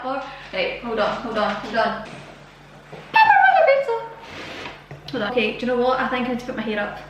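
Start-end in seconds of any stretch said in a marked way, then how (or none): 3.1-3.9 very high, loud squeal; excited squeal. Real, not acted. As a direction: squeal the name with delight. Excited food announcement.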